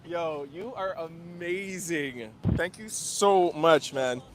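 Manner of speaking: slow, soft, choked-up gratitude; emotional thanks. Real, not acted. Host thanking the artist for his portrait, moved. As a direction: thank her like it really moved you. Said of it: "I'm gonna get, like, a little emotional".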